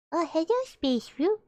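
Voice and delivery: usual speaking voice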